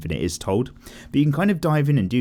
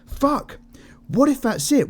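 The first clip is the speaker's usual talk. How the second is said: much higher, excited burst of realisation; thrilled realisation; slower than usual. Retold or imitated. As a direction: blurt it out like a sudden revelation. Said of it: "I was just like".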